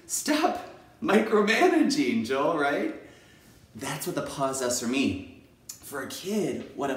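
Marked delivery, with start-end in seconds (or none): 0.1-2.6 high, loud, drawn-out reprimand; hammed-up telling-off; mock self-scolding. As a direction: call yourself out, stretching the words. Acts out scolding themself.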